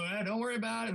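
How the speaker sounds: put-on lightly doing his old friend's Southern accent, fond. Retelling how Vic brushed off comics, a mild mock Southern accent. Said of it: "with that accent".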